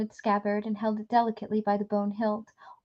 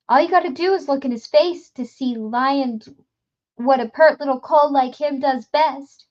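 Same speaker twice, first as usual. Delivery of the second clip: angry accusing; 4 semitones higher, 6 dB louder than usual. Acted. Gasher accusing Jake loudly.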